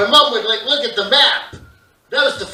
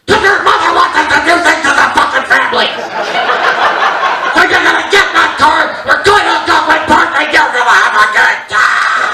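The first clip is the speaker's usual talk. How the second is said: shouted fast, all fury, no nicety; mock angry dad yell; 6 semitones higher, 8 dB louder than usual. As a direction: bark it like a fed-up father. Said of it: "nicely said".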